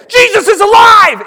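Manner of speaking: high, loud acted proclamation; proclaiming shout. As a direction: shout it out as joyful news. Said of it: "you hear somebody say".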